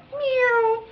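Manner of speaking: quick high "meow" swerve noise; high-pitched zoom; imitated car zoom. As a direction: mimic a car darting off. Imitates car speeding away.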